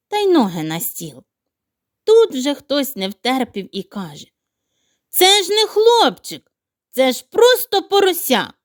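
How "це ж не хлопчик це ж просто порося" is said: indignant outburst. Acted. Someone blurts out the truth.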